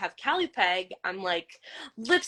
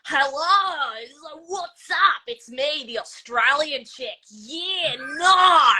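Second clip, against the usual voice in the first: higher-pitched Australian accent, building to a yell; yelling Australian character; 6 semitones higher, slower than usual. Shown as a demonstration. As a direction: shout it out as an Australian game character. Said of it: "I had to yell and scream".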